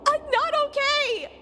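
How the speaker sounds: high, fast, upset rambling. Real, not acted. Streamer grieving a character she meant to cheer up, upset. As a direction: pour out how upset you are. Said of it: "I'm so upset right now".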